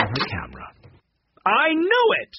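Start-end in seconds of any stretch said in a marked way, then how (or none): 1.5-2.3 high-pitched triumphant cry. A narrator's reading. Monica jumps from the car with her camera, crying out. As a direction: cry it out high, like catching someone. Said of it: "she cried".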